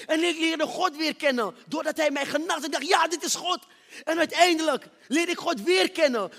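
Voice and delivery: high, loud, building sermon voice; full-force preaching. Acted. Preacher testifying to the congregation, full-force loud preaching.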